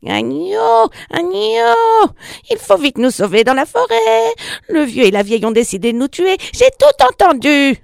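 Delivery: very high, urgent cat voice; panicked alarm. A narrator's reading. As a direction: cry out the warning in high-pitched panic. Cat warns lamb of danger.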